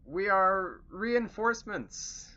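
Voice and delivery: slow, high, loud call in orc disguise; yelling across distance. Acted. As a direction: holler it slowly over a chasm. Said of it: "they're yelling at each other".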